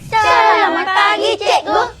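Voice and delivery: slow, high, loud drawn-out greeting; sing-song class greeting. Acted. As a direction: greet the teacher as a chorus of pupils. Class greets teacher in chorus.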